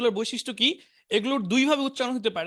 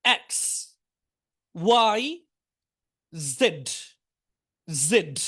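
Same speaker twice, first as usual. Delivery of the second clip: alphabet drill; much slower than usual. Shown as a demonstration. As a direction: call out each letter for the class to repeat. Teacher drilling alphabet aloud.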